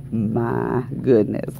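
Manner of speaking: normal talking voice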